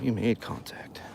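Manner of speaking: breathy whisper under the breath, a little slow; hushed aside to the camera after leaving. Real, not acted. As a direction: whisper it to yourself in disbelief. Walking away, reflecting to self.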